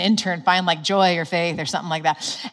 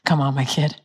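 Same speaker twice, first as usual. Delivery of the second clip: tender invitation; 4 semitones lower than usual. Shown as a demonstration. God calling his child back, tenderly. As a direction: gently coax them home. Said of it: "where he's like this".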